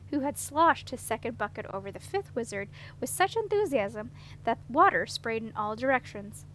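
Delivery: ordinary delivery, nothing marked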